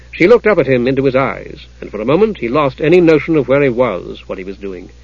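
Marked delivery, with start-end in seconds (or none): none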